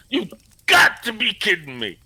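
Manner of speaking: high, loud mock outrage; imitating the first sergeant. Mimicking his first sergeant's catchphrase, high and loud. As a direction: copy the sergeant's disbelief, overdone. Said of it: "How was that?".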